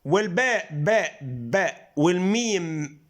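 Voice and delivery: باء باء said slowly, lips pressed, stretched out; slow letter demo; slow bilabial demo. Shown as a demonstration. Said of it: "مخرج واحد".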